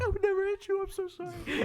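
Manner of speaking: high-pitched, pretend-crying plea; mock tearful apology. Boyfriend apologizing to girlfriend he hit, mock crying. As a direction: squeak out a weepy apology, sending yourself up. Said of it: "I started crying".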